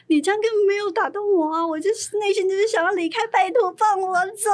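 high, loud, laughing protest; exasperated plea. Retold or imitated. Employee inwardly begging the boss for release, exasperated wail. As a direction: beg to be let go, hammed-up.

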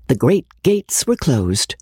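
ordinary delivery

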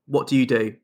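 usual speaking voice

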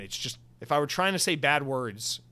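normal talking voice

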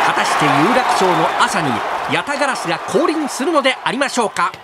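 soaring hype call; sports commentator. Acted. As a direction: shout the big moment.

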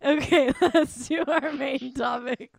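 slow, laughing through it. Real, not acted. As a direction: giggle while moving on. Laughing off the embarrassment.

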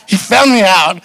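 high, loud mock panic at being caught; hammed-up gasp of being found out; mock alarm. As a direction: play it like a kid caught red-handed. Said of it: "I was like".

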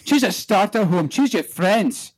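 thick Scottish brogue, high and punchy; Scottish Trainspotting monologue delivery; Scottish accent. Retold or imitated. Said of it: "do Ewan McGregor".

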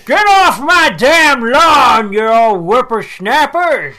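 put-on loud slow cranky old man yell; crotchety old man. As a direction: yell like a grumpy geezer. Voicing crotchety Mark Twain.

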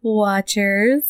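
exaggerated copy with a rising end; mocking imitation. Cohost imitates host's odd delivery, mocking rising lilt. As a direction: parrot the odd drawn-out word. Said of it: "Why'd you say it like that?".

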